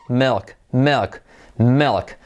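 "milk" with a full L and I like E, "melk"; isolated "milk" drilled with its L; key letter L. Shown as a demonstration. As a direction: sound out "milk" as "melk", L clearly held. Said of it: "letra clave L".

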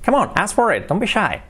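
playful nudge, lightly voiced; coaxing friend. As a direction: egg someone on to ask. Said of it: "No tengas vergüenza".

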